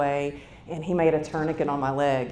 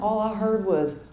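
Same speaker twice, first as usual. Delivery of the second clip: ordinary delivery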